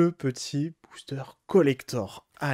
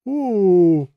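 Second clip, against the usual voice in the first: loud, high oh of delight; a real gasp at the pull; excited oh; 5 semitones higher, 8 dB louder than usual. Real, not acted. Pulls Jurassic Park card.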